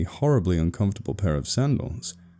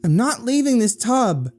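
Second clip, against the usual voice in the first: high-pitched, cross sister; irritated high holler from the tub; irate calling out; 3 dB louder than usual. A narrator's reading. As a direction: refuse loudly through the door. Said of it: "his irate sibling called out".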